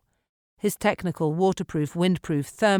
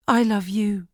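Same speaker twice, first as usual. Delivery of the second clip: higher, fierce I love you; fierce declaration; 4 semitones higher, 3 dB louder than usual. A narrator's reading. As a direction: say it fiercely, holding on. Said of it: "she replied fiercely".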